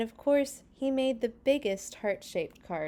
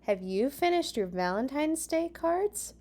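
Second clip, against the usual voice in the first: a hint of a higher girl's voice, asking; lightly voiced Callie; 7 semitones higher than usual. A narrator's reading. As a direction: ask brightly. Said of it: "asked Callie".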